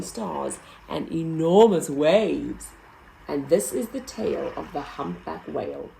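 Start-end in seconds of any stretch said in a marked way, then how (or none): none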